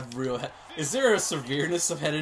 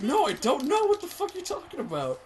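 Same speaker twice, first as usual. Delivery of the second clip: high-pitched imitation of own bewilderment; squeaky mock indignation; retold indignant protest; 12 semitones higher than usual. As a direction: protest high, acting out total confusion. Retelling own indignant confusion.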